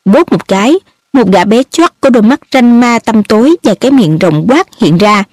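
ordinary delivery, nothing marked

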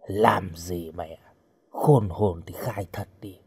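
low, soft threat; menacing captor. Acted. Knifeman to his frightened captive, quiet menace.

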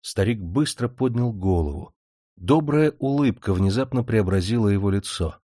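ordinary delivery

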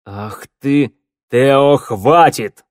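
loud, drawn-out stern order; thundering. A narrator's reading. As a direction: build to a booming command. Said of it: "Голос змеевика прогремел ... точно гром". (громовым голосом)

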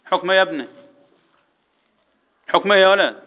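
ordinary talk, nothing marked

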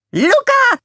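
very high squeaky Minion call. A narrator's reading. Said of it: "rief Bob den anderen Minions zu".

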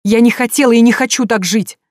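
high, loud desperate outburst; desperate cry. A narrator's reading. Sonya rejecting her husband's life, desperate cry. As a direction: cry it out at him.

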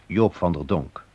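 ordinary delivery

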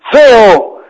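high, loud cry into a cave; sudden high-pitched yell; frightened child's shout. A narrator's reading. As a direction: shout it high like a scared boy. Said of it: "El niño asustado gritó".

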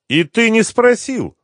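high, loud smirking jibe. A narrator's reading. Gangster mocking the host's story, smirking. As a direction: needle him with a mocking lift in pitch. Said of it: "ухмыльнулся Митя".

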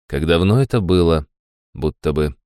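ordinary delivery

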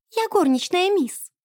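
high little maid's voice; squeak. A narrator's reading. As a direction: squeak it out as a timid maid. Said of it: "пискнула она". (тоненький писк горничной)

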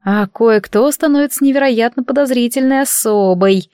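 ordinary delivery, nothing marked

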